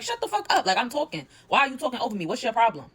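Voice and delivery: furious retold outburst; acted angry snap. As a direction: voice her telling Biggie to shut up. Dia snaps at Biggie in a quarrel.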